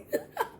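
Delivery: short high-pitched giggle; a quick squeaky giggle. Real, not acted. Laughing at her own disbelief, a high squeaky giggle.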